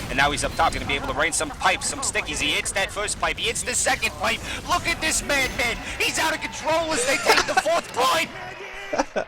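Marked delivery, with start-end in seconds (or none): 1.9-9.3 building excitement. Real, not acted. Caster loses it over the madman's pipes, wildly excited. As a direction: pitch climbing steadily to a very high cry. Climax of a winning play.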